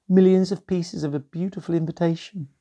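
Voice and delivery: ordinary delivery, nothing marked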